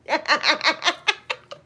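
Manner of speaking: high stuttering laugh. Real, not acted. Laughing because her friend knows, high laugh. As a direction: laugh along in quick bursts.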